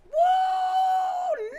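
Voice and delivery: high, loud whooping cheer; excited woo. Real, not acted. Man cheers Gen X leaders, loud whooping woo. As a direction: let out a big celebratory woo.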